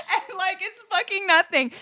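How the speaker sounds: high laughing burst; loud cackle, then talking through laughter; can barely speak for laughing. Real, not acted. Cracking up at the story.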